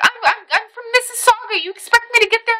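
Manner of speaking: high-pitched imitation of a put-out coworker; indignant protest. Coworker refusing to drive in from Mississauga, high mock protest. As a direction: voice her objection way up high.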